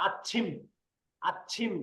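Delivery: "আচ্ছিম" softly, with a held, aspirated "চ্ছ"; soft model of "আচ্ছিম", rising at the end; demonstrated pronunciation. Shown as a demonstration. Teacher shows the sound mid-word, careful "আচ্ছিম" with aspirated "চ্ছ". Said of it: "পরে থাকে তাহলে এইভাবে হবে".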